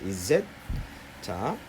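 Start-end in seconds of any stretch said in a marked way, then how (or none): 0.1-0.5 "Is it?" run together as izit; teacher demo of "Is it?" linked. Shown as a demonstration. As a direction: join the S of "is" into "it". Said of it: "it이 아니고 is it 이렇게".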